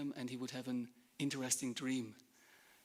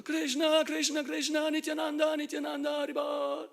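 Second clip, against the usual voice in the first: high, loud chant-like cries tumbling out; ecstatic shouting; 13 semitones higher, 8 dB louder than usual. Acted. Husband and wife both shouting in ecstasy. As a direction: shout the holy names in chaotic rapture. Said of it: "Completely in ecstasy both".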